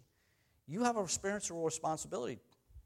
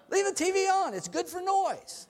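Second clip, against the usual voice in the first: high, raised mock family shout; 12 semitones higher than usual. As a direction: play his noisy family, pitched way up. Voicing his loud family at home.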